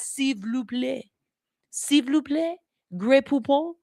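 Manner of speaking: put-on playful, high French accent. Accent lover trying French phrases, playful French accent. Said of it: "Give me a good accent".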